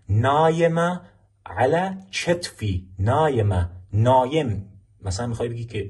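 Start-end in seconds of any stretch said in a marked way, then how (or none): 0.1-2.9 چتفی with a ch, drawn out slowly; تلفظ با چه. Shown as a demonstration. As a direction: model چتفی slowly as chet-fi, pitched up. (چتفی کشیده و با صدای «چ»)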